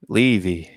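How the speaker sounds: "Levy" with a long ee, set apart; pronounced LEE-vee. Shown as a demonstration. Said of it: "apparently it's pronounced".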